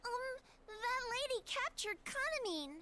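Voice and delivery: put-on high, teary child voice; tearful little girl. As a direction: hold back tears as a small girl. Child voice near tears.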